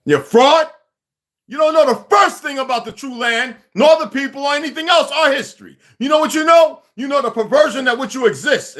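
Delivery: high-pitched, heated telling-off; angry tirade. Real, not acted. Angry rant at a challenger.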